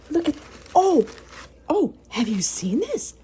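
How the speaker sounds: high-pitched excited disbelief; amazed. Real, not acted. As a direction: exclaim it high, astonished by the find. Amazed by the cookie.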